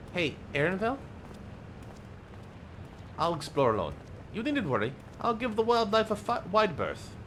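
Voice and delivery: lightly voiced character.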